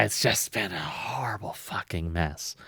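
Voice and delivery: usual speaking voice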